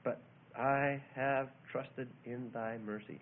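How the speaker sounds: slow, low, settled reading; measured trust. Acted. David committing himself to God, slow and settled. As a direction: let each word rest, pitched low. Repeats the verse of faith.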